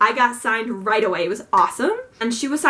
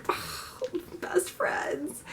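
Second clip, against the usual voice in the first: whisper; 6 semitones higher, 12 dB quieter, much slower than usual. Acted. Teasing aside about Margie.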